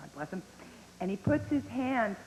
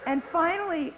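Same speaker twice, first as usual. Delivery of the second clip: ordinary delivery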